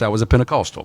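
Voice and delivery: ordinary delivery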